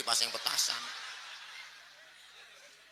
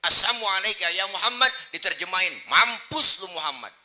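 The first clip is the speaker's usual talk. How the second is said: high, loud hostile quote; impassioned preaching; 8 semitones higher, 11 dB louder than usual. Acted. Preacher acting unbeliever cursing the Prophet, impassioned preaching. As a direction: hurl the curse at full voice.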